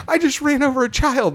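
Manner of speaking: very high, mock-distraught wail; imitated panic. Steamroller driver thinking he killed a child, mock panicked. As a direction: play at flipping out, pitch jumped way up. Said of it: "he's flipping out crying".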